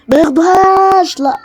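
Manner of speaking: high, loud urgent cry; urgent plea. Real, not acted. Gamer begging teammates not to grab it, urgent plea. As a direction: plead in alarm. Pleading teammates don't take it.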